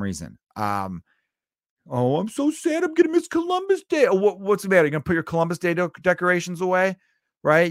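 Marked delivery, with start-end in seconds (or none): 1.9-4.1 much higher, whiny fake-sad voice; mock sad whiner. Mocking sad Columbus Day fans. 4.1-7.7 higher, louder, fake pity for the fans; mock sympathy.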